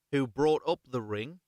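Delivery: usual speaking voice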